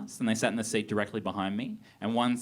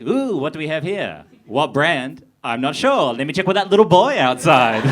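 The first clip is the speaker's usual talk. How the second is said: hammed-up, louder and higher, laughing through; mock clueless customer; 4 semitones higher, 10 dB louder than usual. Comedian acting himself at the counter, loud mock-clueless, laughing. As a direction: play the lost first-time buyer, loudly. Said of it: "Just like".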